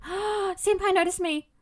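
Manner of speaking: high, slow gasping fangirl; breathless awe at being noticed; excited anime fan. Real, not acted. Gasps at dev repost.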